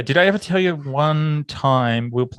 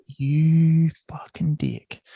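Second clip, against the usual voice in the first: muttered insult; 4 semitones higher, much slower than usual. Retold or imitated. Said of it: "And I thought".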